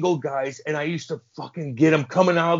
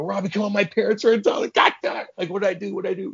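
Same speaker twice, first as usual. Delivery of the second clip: high-pitched, panicky pleading; freaking out; 6 semitones higher, faster than usual. Retold or imitated. Player begging coach with parents in town, freaking out. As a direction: plead with the coach in rising alarm. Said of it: "You know, freaking out".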